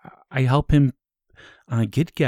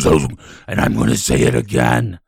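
demon voice; 13 semitones lower, 6 dB louder than usual. Acted. Possessed voice returns.

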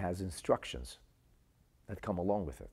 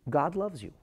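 raised, urgent plea of a doctor; earnest pleading; 7 semitones higher than usual. Retold or imitated. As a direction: press the words on her, higher and fervent. Physician pleads with suicidal girl.

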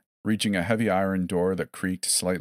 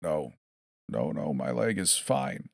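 labored breaths; 3 semitones lower than usual. A narrator's reading. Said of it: "spitting blood between labored breaths".

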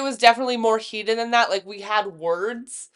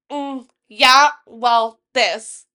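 smug drawn-out brush-off, a bit louder; cocky; 7 dB louder, much slower than usual. Retold or imitated. Friend mocking Cheyenne at the bar, cocky and dismissive. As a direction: act real cocky, dismissing him. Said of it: "real cocky".

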